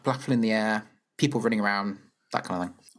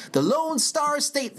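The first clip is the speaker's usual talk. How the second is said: slow, higher mock Texan boast; mock Texan pride; 11 semitones higher, much slower than usual. Hamming up Texas pride on the podcast, slow mock Texan accent. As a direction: draw it out like a proud Texan. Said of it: "That's what we do in Texas".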